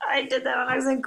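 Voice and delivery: usual speaking voice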